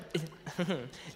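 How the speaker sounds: short, soft chuckle; real little laugh between phrases. Real, not acted. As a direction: chuckle before breaking the news. Laughs before announcing budget.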